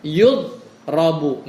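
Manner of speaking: Yudrobu spelled out slowly, each syllable held; slow modelled answer. Shown as a demonstration. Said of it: "Kan yadribu kalau dimajhulin jadi apa". (Yudrobu diucapkan lambat dan jelas)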